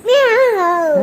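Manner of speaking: loud, slow cat meow; child meowing. Pretend. Toddler answering her mother with a loud drawn-out meow. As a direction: yowl out a long cat meow. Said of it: "कोणाचा आवाज आहे हा".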